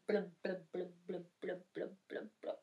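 soft, low blub-blub; sinking bubble noises. Acted. Storyteller as the troll sinks under the river, soft bubbling. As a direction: bubble down quietly, sinking away.

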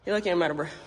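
normal talking voice

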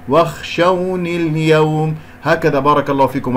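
normal talking voice

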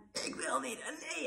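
imitated child's protest, small and high; resisting child. Son pulling his arm from the doctor, resisting. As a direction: softly mimic a little boy refusing.

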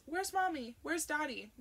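high little-girl voice, lightly imitated; child asking. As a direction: mimic a small child asking for her parents. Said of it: "She used to ask us".

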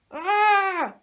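high, loud anguished scream; anguished cry. Acted. Josie wails before running away. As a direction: let out a raw wail. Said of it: "Josie cried".

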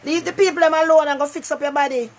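usual speaking voice

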